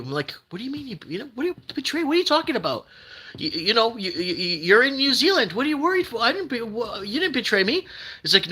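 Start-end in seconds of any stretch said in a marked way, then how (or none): 0.5-7.9 incredulous reply. Retold or imitated. Retells his reply to crying viewer.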